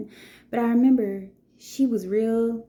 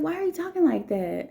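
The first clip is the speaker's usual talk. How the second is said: higher pitched, pressing question; high retold challenge; 8 semitones higher than usual. Teacher retelling her challenge to a rough-talking girl, pitched high. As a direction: demand to know why, voice lifted.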